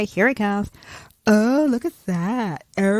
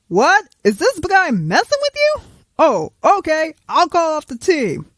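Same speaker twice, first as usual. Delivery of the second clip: high, loud surprised hero; Superman voice; 6 semitones higher, 4 dB louder than usual. Acted. Superman answering the call, high and loud. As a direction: play an indignant Superman.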